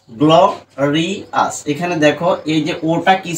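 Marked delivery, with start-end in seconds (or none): 0.1-1.5 গ্লোরিয়াস slowly, in three separate parts; broken into syllables. Shown as a demonstration. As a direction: pause between each syllable of গ্লোরিয়াস. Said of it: "ভেঙে ভেঙে উচ্চারণ করি".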